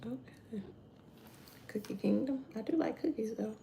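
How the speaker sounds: soft whispered aside. Real, not acted. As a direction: whisper it to yourself.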